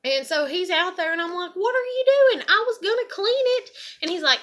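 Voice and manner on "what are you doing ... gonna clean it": high, loud protest; retold yell. Yelling at her dad scrubbing the bowl, loud and high. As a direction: yell it out the door in outrage.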